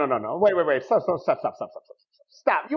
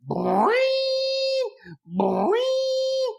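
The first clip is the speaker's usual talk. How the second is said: imitated alarm.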